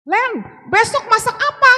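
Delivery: imitating a husband hollering to his wife; high, forceful shout that sounds like scolding; loud high-toned speech. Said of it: "ibu kalau ngomong kayak gitu marah-marah". (menirukan suami berteriak dengan nada tinggi)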